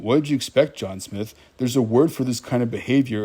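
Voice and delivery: ordinary talk, nothing marked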